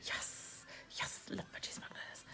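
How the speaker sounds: very soft, voiceless whisper; whispered aside. Real, not acted. Agreeing about Budgie Smugglers after partner's tease, whispered.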